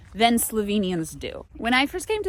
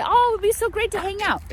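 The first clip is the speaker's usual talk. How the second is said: breezy promise; 9 semitones higher than usual. Retold or imitated. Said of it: "we say".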